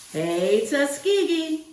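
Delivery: bright rising call of the school name; cheering hello. Pretend. Returning family hailing their old campus, excited cheer.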